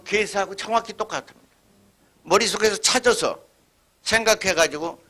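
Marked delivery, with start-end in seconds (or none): none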